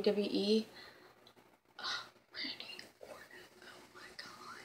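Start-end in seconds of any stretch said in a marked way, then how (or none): 1.8-4.6 breathy swooning whisper. Real, not acted. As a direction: whisper it, starstruck. Swooning over wrestler crush.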